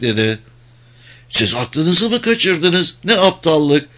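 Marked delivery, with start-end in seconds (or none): 1.3-3.9 higher-pitched, restrained narrated shout; indignant shout. A narrator's reading. Aleksandra scolds them and orders them up, mild shout. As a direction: read it as a mild, high-pitched outcry. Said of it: "diye bağırdı".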